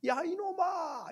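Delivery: high, lighter repeat of a yell; echo. Retold or imitated. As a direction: throw it back as a distant echo. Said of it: "이렇게 들리는 거예요".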